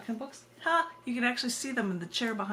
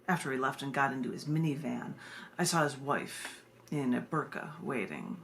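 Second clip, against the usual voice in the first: low, measured spoken-word reading; poem reading; 5 semitones lower than usual. Acted. Poet reading a slice-of-life poem to her viewers, low and measured. Reads the coffee line poem.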